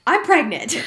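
loud, high sudden blurt. Retold or imitated. Wife retelling how she told her husband, blurting it. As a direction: blurt the news out, pitch leaping up. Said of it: "like, blurted it out".

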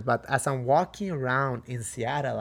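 usual speaking voice

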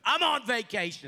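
loud, high-pitched protest; hammed-up indignation; acted indignant refusal. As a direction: protest loudly, as if put upon. Acting out his own refusal.

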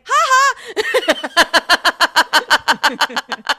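loud, high two-beat "ha ha"; mocking laugh. Retelling brother Adrian's reaction, loud mock laugh. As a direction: burst out with a brash mock laugh. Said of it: "our brother Adrian went".

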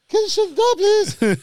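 very high, loud falsetto plea; high-pitched voice. Retold or imitated. Naked man calling after the chambermaid, high-pitched shriek. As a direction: squeal the request in panic. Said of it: "in a high-pitched voice".

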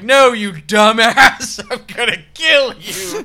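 loud, high, very slow mock threat; menacing father. Father threatening his son with a hammer, hammed up. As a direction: ham up the killer dad. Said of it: "It's like".

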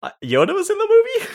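high, fast, laughing surprise; surprised. Retold or imitated. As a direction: squeak it out, baffled and laughing. Retelling own clueless reply.

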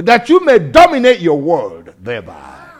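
loud preaching shout; shouted declaration. Real, not acted. Preacher proclaiming victory to the congregation, shouting. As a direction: declare it to the whole room.

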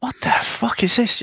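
high, bewildered what the fuck is this; incredulous. Retold or imitated. Fan meeting the Gungans, high incredulous outburst. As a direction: recoil in disbelief at the Gungans. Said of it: "And you're like".

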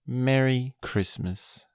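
ordinary delivery

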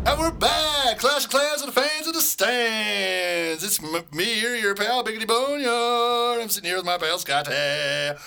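high, loud, slow announcer boom; radio announcer voice. Acted. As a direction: welcome listeners like a radio host. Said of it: "radio announcer coming on in".

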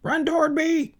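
very high gander squawk; high-pitched honking call; gander yelling. Acted. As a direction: yell it in a squeaky bird voice. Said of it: "yelled the gander".

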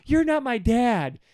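put-on high, loud, drawn-out teenage protest; kid. Kid rebuffing the insurance rep in a commercial, whiny high yell. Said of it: "the kid's like".

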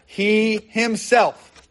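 slow, weighty stress on both "He" and "himself"; "He himself" drawn out with deliberate emphasis; emphatic. Shown as a demonstration. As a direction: stretch out "He himself", pressing on each word. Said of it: "there's the emphasis".

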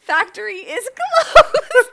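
laughing, rising to shriek. Real, not acted.

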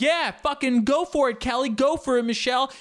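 very high, loud mock cheer; cheering along. Cheering on classmates on the screen, high and loud. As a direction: yell encouragement at the screen. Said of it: "I'll be like".

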